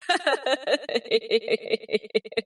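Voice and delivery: long, evenly pulsed laughter; drawn-out gloating ha-ha laugh; boastful laugh. Acted. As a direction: laugh on triumphantly after the boast. Laughs after boss fight boast.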